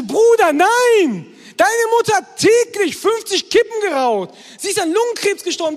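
high, loud mock protest at the son; Bruder, nein! — exasperated yelling back; indignant retort. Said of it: "Und ich dachte so". (empört und laut, hoch gerufen)

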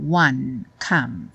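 "one" and "come" ending dry on n and m; "one, come" with no vowel after; clipped one come. Shown as a demonstration. Teacher models endings: "one, come" closed on n and m. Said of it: "terminar o som no N ou no M".

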